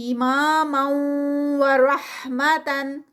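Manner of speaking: very slow رحمتن with the tanween merged into the و; Quranic recitation. Shown as a demonstration. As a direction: chant it slowly as a tajweed drill. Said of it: "تنوین ہے وہ آگے واو میں مرج ہوگی".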